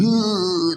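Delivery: very high, loud "ooh"; big high whoop for the story; drawn-out high imitation noise; loud mock wail. Imitating noise in retold fight.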